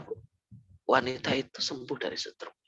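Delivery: ordinary talk, nothing marked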